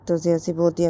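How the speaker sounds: usual speaking voice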